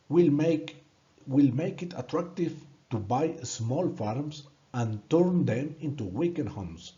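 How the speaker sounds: normal talking voice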